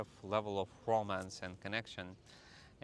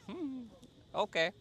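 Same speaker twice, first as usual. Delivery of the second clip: sceptical hmm; 13 semitones higher, 4 dB louder than usual. Retold or imitated. Girlfriend doubting he can move clouds, sceptical. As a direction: humour him without believing a word.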